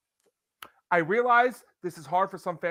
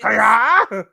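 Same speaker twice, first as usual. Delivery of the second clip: Mario yell; 10 dB louder than usual. Retold or imitated. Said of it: "even more".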